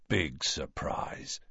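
low, slow, hushed aside; soft, drawn-out murmur; whispered. A narrator's reading. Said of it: "whispered Ron".

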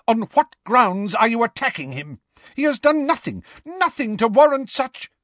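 high outraged demand; indignant. A narrator's reading. McGonagall confronting the attackers.

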